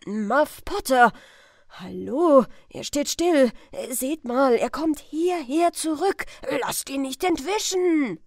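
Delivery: rising hue and cry; excited shouting. Acted. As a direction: shout to stop him escaping. Crowd spotting the suspect.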